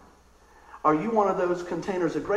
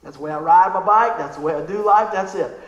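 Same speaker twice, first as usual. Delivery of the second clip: normal talking voice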